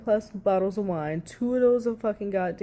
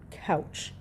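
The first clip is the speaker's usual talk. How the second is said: whispered mock couch; 4 semitones lower, 6 dB quieter than usual. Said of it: "like a fucking toddler".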